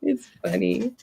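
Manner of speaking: husky, hoarse voice from a cold; smoky sick voice. Real, not acted. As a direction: talk through a sore, smoky throat. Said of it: "[name] has a deep, sexy voice today".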